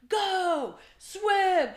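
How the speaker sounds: loud, high-pitched swim coach yell; yelled command; urgent shout. Retold or imitated. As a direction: shout it like driving swimmers on at the pool. Shouting at slow morning swimmers.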